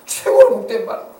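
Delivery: rising to a high, loud cry; fervent climax. Real, not acted. Preacher exhorting his congregation, climbing fervent cry. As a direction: build the praise to a peak.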